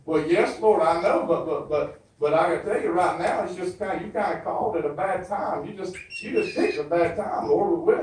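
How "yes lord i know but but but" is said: ordinary delivery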